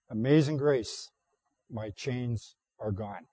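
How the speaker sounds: ordinary delivery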